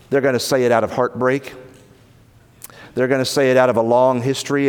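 ordinary delivery

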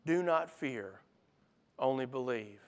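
soft, low, gentle promise; quietly steady a frightened father; tender reassurance. Acted. Jesus comforts grieving father.

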